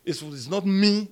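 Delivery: normal talking voice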